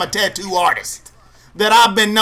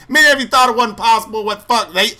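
normal talking voice

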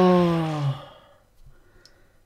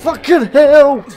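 loud, higher desperate yelling; shouting in panic as a door locks; panicked shouting; 8 semitones higher, 8 dB louder than usual. Real, not acted.

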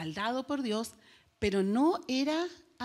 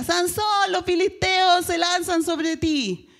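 grito muy fuerte y agudo, arrastrado; grito de Dalila; 7 semitones higher, 7 dB louder, slower than usual. Acted. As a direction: grita el aviso a todo pulmón. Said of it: "y Dalila grita". (Dalila alerta a Sansón: grito muy fuerte y agudo)

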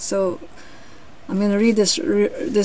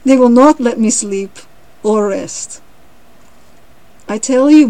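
loud, emphatic complaint; exasperated lion; 3 semitones higher, 9 dB louder than usual. A narrator's reading. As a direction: hit each phrase hard, then ease off. Tired lion complains to the cricket.

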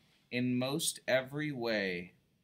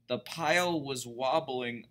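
ordinary delivery, nothing marked

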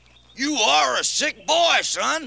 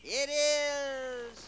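fake genie voice; 9 dB quieter than usual. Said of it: "Your voice has changed".

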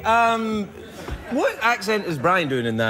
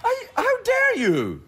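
high, drawn-out playful outrage; mock indignation; slower than usual. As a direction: act wildly affronted, slowly. Mock offence at hamming accusation.

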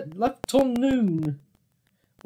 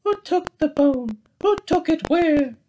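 anxious question; 10 semitones higher than usual. Acted. Doctor Bear asks anxiously.

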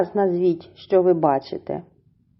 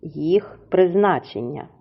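normal talking voice

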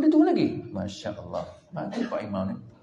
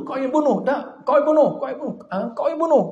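raised, heated voices throwing blame back and forth; mock accusing quarrel; 6 semitones higher, 6 dB louder than usual. Bani Israel tribes accusing each other, heated blame. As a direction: accuse each other loudly, one after another. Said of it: "tuduh-menuduh".

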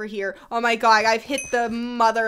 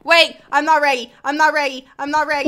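panicked; 4 semitones higher, 6 dB louder, faster than usual. Real, not acted. Panic in game attack.

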